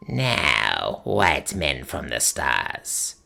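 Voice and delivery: low, slow, eerie crone voice; piping old witch. Acted. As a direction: croak it out like an ancient witch. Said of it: "she piped".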